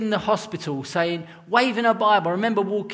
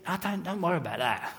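quick, softer Cockney brush-off; Cockney accent; 8 dB quieter, faster than usual. Retold or imitated. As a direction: wave it away in a broad Cockney accent. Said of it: "he didn't say it with a Cockney accent".

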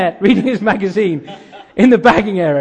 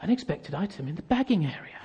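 very soft automated checkout prompt; patronizing machine voice; 4 semitones lower, 13 dB quieter than usual. Acted. Checkout winds up the customer, softly patronizing. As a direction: imitate the till, hushed and condescending. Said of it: "That patronizing voice".

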